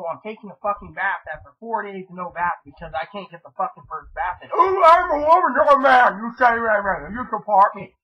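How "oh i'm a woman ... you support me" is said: much higher, loud whiny mimicry of her; mock-whine imitating the roommate, loud and high. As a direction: parody her fussing, voice pushed up.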